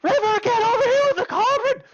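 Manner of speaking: very high, loud summons; high-pitched yell. Acted. Someone yells for River to bring the cauldron, piercing high shout. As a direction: shriek it across the house. Girl's household calls River over.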